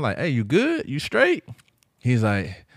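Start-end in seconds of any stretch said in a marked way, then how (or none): 0.2-1.4 high, rising check-in questions; questions lifting sharply in pitch; retold concerned check-in. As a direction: act out checking on a friend. Retells checking on nervous friend.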